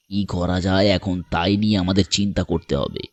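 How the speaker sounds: low, measured, thoughtful voice; calm stranger. Acted. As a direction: reason calmly with an upset child. Stranger calmly reasons with boy. (শান্ত, নিচু, ভাবুক গলা)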